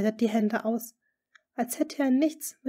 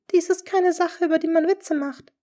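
trembling voice; 9 semitones higher, 8 dB louder than usual. A narrator's reading. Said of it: "mit zitternder Stimme".

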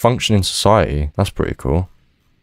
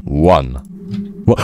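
ordinary talk

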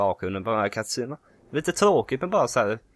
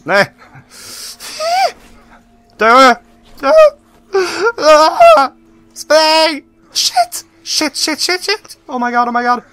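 shrieking, much higher and louder, near tears; panicked shrieks; 11 dB louder, much slower than usual. Real, not acted. Gamer swarmed while escaping, panicked shrieks. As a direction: scream in pure panic.

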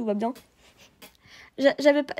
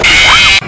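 jumpscare scream; 9 semitones lower, 19 dB louder than usual. Real, not acted. Jumpscare makes her drop phone.

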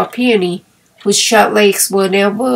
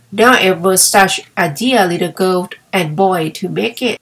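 plain reading voice

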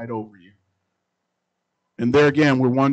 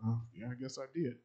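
sheepish admission; 13 dB quieter than usual. Retold or imitated. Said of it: "he just puts his head down".

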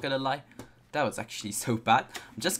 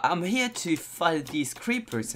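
usual speaking voice

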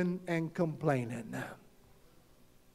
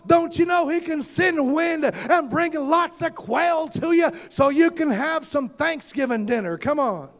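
preaching at full force; 8 semitones higher than usual. Acted. Preacher rousing the congregation, full-force preaching. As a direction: preach it out, voicing the complainers.

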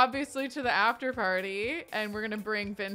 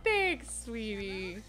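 sweet reply; 5 dB quieter, much slower than usual. Retold or imitated. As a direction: voice him tenderly. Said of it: "He was like".

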